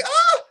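very high, loud mock scream; panicked yelp. Drummer not yet warmed up with the band, panicked yelp. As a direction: yelp as if caught unready. Retelling panic before first playing.

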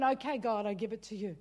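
gentle prayer of surrender. Retold or imitated. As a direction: hand it over quietly.

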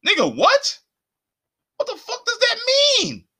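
high, squealed disbelief; incredulous outburst. Real, not acted. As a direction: burst out baffled, voice shooting up. Outraged reply to easy acceptance.

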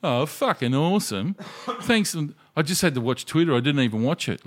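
hammed-up sarcastic viewer; sarcastic complaint. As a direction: gripe sarcastically about spoilers. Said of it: "people from W A ... go".

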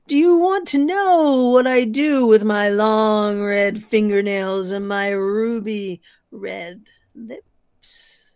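eerie ghost voice. Acted. Storyteller voicing the ghost entering the room, slow and loud. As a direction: loom over him with each word.

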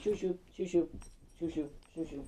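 high, quick repeated shooing; shooing a dog. Real, not acted.

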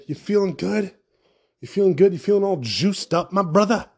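a touch of a bro hype-man; hype host greeting. Acted. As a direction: hype up the listener like a buddy. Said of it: "that was weird".

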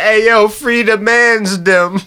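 lightly done London road-man drawl; imitating London accent. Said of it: "rapping with the accent".

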